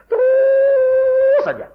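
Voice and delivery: a ringing, endless "Terus"; long high drawn-out cry. Acted. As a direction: hold "Terus" high and loud like an endless call. Forever goes on and on.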